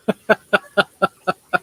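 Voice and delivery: long snorting laugh; real laughing fit with a snort. Real, not acted. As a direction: laugh until you snort. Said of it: "I'm snorting".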